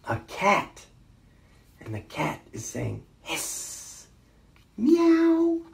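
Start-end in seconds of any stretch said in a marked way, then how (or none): 3.2-4.1 voiceless cat hiss. A narrator's reading. Cat in the story, hissing. 4.8-5.6 high, loud meow; cat meow. A narrator's reading. Cat in the story, meowing.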